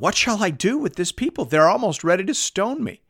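high-pitched, exasperated plea; crying out. A narrator's reading. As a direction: read it as a desperate appeal to God. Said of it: "Moses cried to the Lord".

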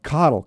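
Cottle repeated as KOT-ul; corrected name pronunciation. Shown as a demonstration. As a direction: stress the first syllable of Cottle and let it fall. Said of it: "so that's Cottle".

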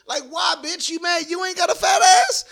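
mock retort to an offended woman; mock shout. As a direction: snap back at her, hammed up.

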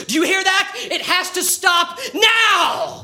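high, loud slow demand; desperate shout. Acted. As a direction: yell it at the monster. Shouting at the creature.

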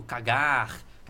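Rio accent, "cagar" ending in a throaty, breathed R; carioca accent. Shown as a demonstration. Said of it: "pro carioca muda, tipo".

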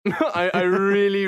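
laughing. Real, not acted. Guest laughing as she admits hating the controllers. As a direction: break into a real laugh mid-sentence.

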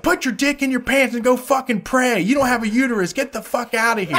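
retold scream. Lady telling off the protester outside, mock scream. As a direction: imitate her telling him off, pitched well up. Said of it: "she starts screaming at the guy".

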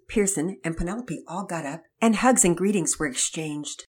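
plain reading voice

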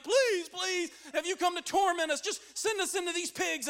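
high, hurried mock pleading; freaking-out demons. Demons begging Jesus for mercy, in a high frantic plea. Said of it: "these demons start freaking out".

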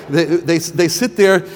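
ordinary talk, nothing marked

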